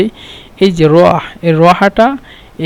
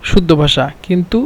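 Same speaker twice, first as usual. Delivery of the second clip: ordinary talk, nothing marked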